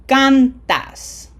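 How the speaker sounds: CANtas, high loud CAN, falling end; stress on first syllable. Shown as a demonstration. Teacher models cantas for learners, stress on CAN. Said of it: "emphasis is in syllable number two".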